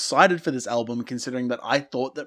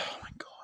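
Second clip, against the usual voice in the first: exasperated sigh; 22 dB quieter, much faster than usual. Real, not acted. Sighs at the lyrics.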